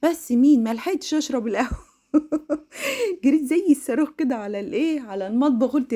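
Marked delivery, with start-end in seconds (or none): 2.1-3.2 sudden high laugh at herself; bright amused cackle; high burst of laughter. Real, not acted. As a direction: laugh out at being caught rushing. Laughing at her own rush.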